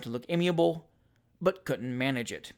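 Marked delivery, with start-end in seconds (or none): none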